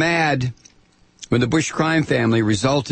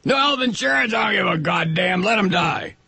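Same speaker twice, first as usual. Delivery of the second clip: mock heckler shout; 7 semitones higher than usual. Ranter mimicking right-wingers shouting down health care. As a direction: ham up the heartless crowd. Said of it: "what you all scream out".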